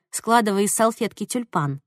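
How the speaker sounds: ordinary delivery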